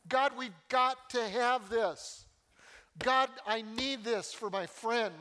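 impassioned, slow prayer for a friend; earnest plea. Acted. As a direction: plead with God as if your heart will break. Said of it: "Pleading says".

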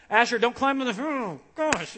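high, loud mock scolding, breaking off; exasperated parent. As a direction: act out a dad's frantic warning. Father acting out scolding his toddler.

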